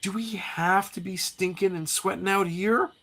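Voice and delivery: high-pitched whiny complaint; complaining comic character. Acted. Jubilee complaining to Wolverine, high whine.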